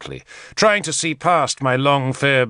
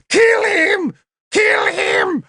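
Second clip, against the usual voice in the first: very high shriek; frenzied scream; 4 dB louder, much slower than usual. A narrator's reading. Tanabers leaping about, demanding death, shrill scream. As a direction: shriek for blood, wildly.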